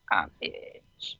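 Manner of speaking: whispered aside; muttered under breath. Real, not acted. Muttered aside while adjusting phone.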